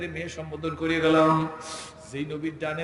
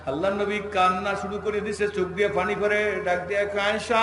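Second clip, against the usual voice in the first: higher melodic sermon narration; chant-like telling that ends in a call; raised preaching; 4 semitones higher, 6 dB louder than usual. Acted. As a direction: swell into a long, sung-out name.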